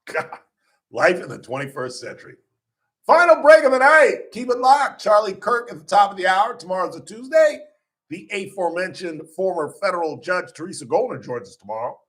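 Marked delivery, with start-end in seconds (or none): 3.1-12.0 high, loud upbeat promo read; radio announcer. Acted. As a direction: pump up the final break like a DJ. Radio host break announcement.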